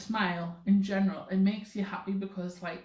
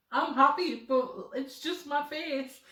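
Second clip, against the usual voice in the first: protesting retort; 7 semitones higher than usual. Retold or imitated. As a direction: insist in mock defence, pitched up. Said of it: "And I'd be like".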